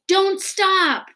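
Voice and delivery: high, loud yell while running. A narrator's reading. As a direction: shout to keep him going. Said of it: "would yell".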